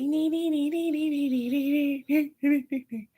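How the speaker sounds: high, loud nagging nee nee nee; mocking nee nee. Her imitating the nagging in a high loud whine. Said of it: "She's like".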